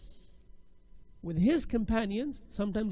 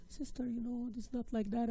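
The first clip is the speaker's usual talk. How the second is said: soft, higher, gentle correction; nice; 3 semitones higher, 5 dB quieter than usual. Pretend. Teacher corrects another sister's same mistake, nice and soft. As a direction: go easy on her, softly. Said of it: "and I'm nice".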